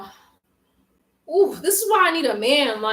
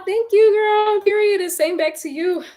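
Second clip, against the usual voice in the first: high, loud appreciative exclamation; gushing thanks; 9 semitones higher, 4 dB louder than usual. Real, not acted. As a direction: sing out your gratitude to a friend.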